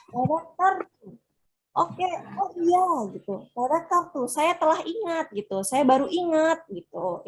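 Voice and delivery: high-pitched "oh, I just remembered"; sudden-remembering exclamation. Shown as a demonstration. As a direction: exclaim as if it just came back to you. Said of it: "berarti dia intonasinya seperti itu ya".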